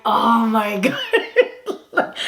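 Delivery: slow oh my god broken by a laugh; drawn-out laughing oh my God; laughing disbelief. Real, not acted. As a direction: laugh through the words, stunned. Mortified at funeral.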